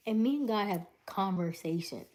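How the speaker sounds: usual speaking voice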